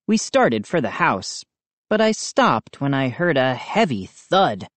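ordinary delivery, nothing marked

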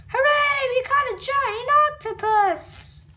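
high, loud hooray; excited cheer. Acted. Fishermen cheering a giant octopus catch, loud and excited. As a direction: celebrate the big catch.

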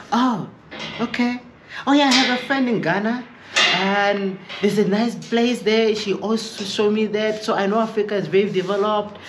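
high, breezy imitation; mock American acquaintance. Voicing the Savannah questioner.

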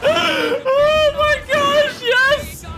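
very high, loud, drawn-out squeal of joy; real ecstatic shouting; thrilled scream. Real, not acted. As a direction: shriek it out in delight, stretched long. Thrilled reaction to clip.

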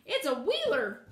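loud, slow alarmed hen cry; frightened hen. A narrator's reading. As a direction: warn her in fright, drawing it out. Hen cries warning in fright.

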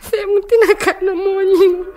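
high, slow tearful plea to God; a lonely invalid woman weeping in panic; frightened crying. Acted. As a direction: let the fear break into tears, drawn out.

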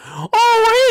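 big high gasp of surprise; startled high gasp; loud gasp. Acted. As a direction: gasp sharply, pitch way up. Gasp at Jeffy.

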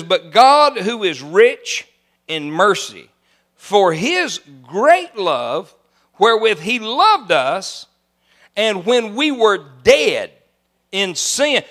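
very slow, drawn-out preaching read; solemn scripture reading. Acted. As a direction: savour each phrase slowly.

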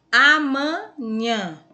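amanhã loud and slow, in separated syllables. Shown as a demonstration. Teacher helping pupils find the stress in amanhã, syllable by syllable. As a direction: sound out amanhã slowly and high, piece by piece. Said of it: "pronunciou com mais intensidade".

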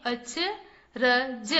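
अचरज stretched slowly as अ-च-र-ज; syllable by syllable. Shown as a demonstration. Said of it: "चलिए बच्चों, पढ़ते हैं।".

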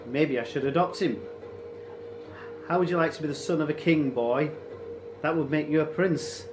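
mocking raider chief. Raider teases the boy with adoption, mocking. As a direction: dangle the prize in front of him.

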